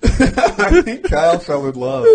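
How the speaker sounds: loud, slow teasing laugh-talk. Real, not acted. Laughs while teasing Kyle.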